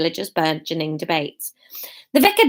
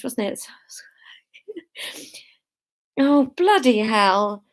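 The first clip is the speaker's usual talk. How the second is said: ordinary delivery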